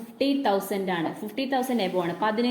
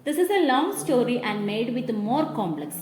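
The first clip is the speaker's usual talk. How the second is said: reading the English text very slowly for notes; measured classroom dictation; 5 dB quieter, much slower than usual. Shown as a demonstration. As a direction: read it out slowly so the class can write it down.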